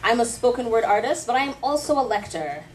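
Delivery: ordinary talk, nothing marked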